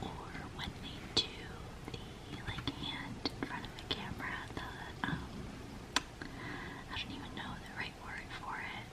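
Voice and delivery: soft unvoiced ASMR whisper. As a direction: whisper close to the mic, lingering on words. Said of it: "Anywho, back to real life".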